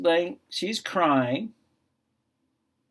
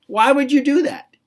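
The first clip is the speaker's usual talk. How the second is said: incredulous question; 10 semitones higher, 7 dB louder than usual. Real, not acted. Asking kids a rhetorical question.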